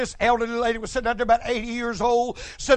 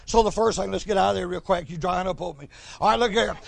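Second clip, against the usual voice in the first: ordinary talk, nothing marked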